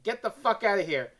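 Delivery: ordinary talk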